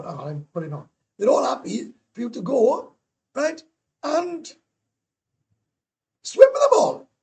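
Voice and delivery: imitating her answer, building to delight; excited retelling. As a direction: climb into a thrilled squeal. Retold owner's yes, rising excitement.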